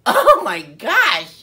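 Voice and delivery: loud, drawn-out cry of exasperation; slow, exploding disbelief; exasperated outburst. Retold or imitated. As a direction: blurt it out high and very loud. Exasperated that she was snoring.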